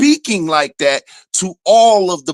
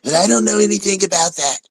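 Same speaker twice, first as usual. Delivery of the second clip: quick, higher-pitched imitation of a politician; mock denial; 5 semitones higher, faster than usual. Politician asked about Project twenty twenty-five, mock denial. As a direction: rush out a put-on plea of ignorance. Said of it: "when they asked him about Project twenty".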